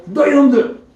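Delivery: a retold angry shout at delinquents; angry yell. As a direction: bark it like a stranger catching bullies. Stranger yells at bullies.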